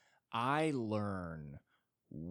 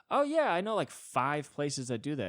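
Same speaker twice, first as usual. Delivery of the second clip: much higher, smug been-there reply; mock know-it-all; 6 semitones higher, 6 dB louder than usual. Friend brushing off a restaurant find, mock smug and higher. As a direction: squeak it out, unimpressed. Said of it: "And then they go".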